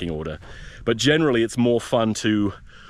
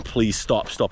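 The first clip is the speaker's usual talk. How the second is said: usual speaking voice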